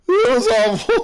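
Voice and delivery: high, squeaky laughing words. Real, not acted. Gamer still cracking up at own mistake, laughing high.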